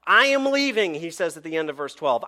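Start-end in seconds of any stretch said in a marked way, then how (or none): 0.0-0.9 loud, high and emphatic; raised, pressing announcement; urgent. Acted. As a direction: declare it with urgency. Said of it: "Do you catch the sense of urgency".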